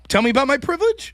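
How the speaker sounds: high, loud rhetorical question; sarcastic challenge. Retold or imitated. Poor student confronting privileged opponent, sarcastic challenge. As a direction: dare them to lecture you, sarcastically.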